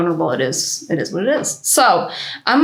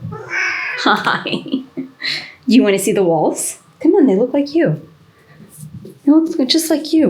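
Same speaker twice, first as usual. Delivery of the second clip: higher, playful cat talk; pet talk; 5 semitones higher than usual. Real, not acted. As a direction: chat to the cat, pitched up. Talking to the cat.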